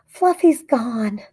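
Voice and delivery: upset cry. A narrator's reading. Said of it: "Emma cried".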